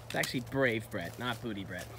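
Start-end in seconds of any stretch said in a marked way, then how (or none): none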